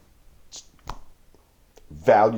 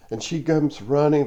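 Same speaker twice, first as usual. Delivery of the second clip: usual speaking voice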